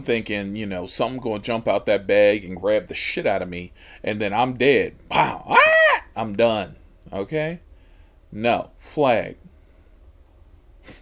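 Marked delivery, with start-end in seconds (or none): 5.5-6.0 sudden high shriek of fright; startled scream. Retold or imitated. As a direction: shriek like something jumped out. Terrified by the bag.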